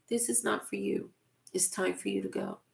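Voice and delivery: 1 soft inner voice. Acted. Still small voice quoted.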